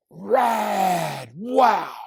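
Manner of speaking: high, drawn-out awe; wowed exclamation. A narrator's reading. Chloe reacts to Judy's game, high and wowed.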